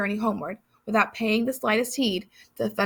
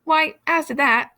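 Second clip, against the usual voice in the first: giant voice; 7 semitones higher, 5 dB louder than usual. Acted. Giant's slow reply.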